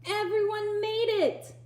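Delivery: high, delighted exclamation; high surprised cry of delight; exclaiming. Acted. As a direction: gasp it out with joy at the family. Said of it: "Ethan exclaimed".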